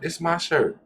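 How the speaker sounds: ordinary talk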